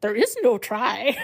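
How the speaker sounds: slow, odd little Yoda croak. Retold or imitated. Quoting Yoda to the listeners, in a slow put-on Yoda voice. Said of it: "speaks in a very distinct way".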